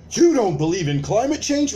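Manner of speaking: loud, high accusing question; mock accusing challenge. Accuser confronting a skeptic, loud and accusing. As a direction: jab it at him like a gotcha. Acted accuser in word game.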